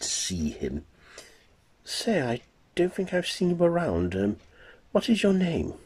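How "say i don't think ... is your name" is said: put-on much higher, breathy-free young woman voice, teasing.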